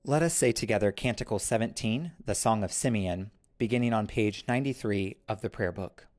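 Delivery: usual speaking voice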